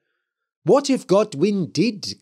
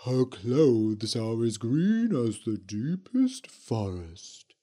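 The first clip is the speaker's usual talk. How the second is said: slow, lower eerie witch; drawn-out spooky ghost witch; 4 semitones lower than usual. A narrator's reading. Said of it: "said the Green Witch".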